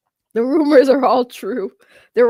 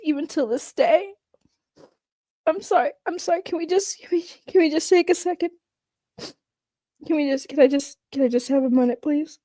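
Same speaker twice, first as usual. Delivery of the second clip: higher, trembling with held-back tears; acted tearful breakdown; 7 semitones higher than usual. Coffee girl breaking down mid-testimony, begging for a minute, tearful. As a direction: let the tears come as she pleads for a second. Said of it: "I actually start really tearing up".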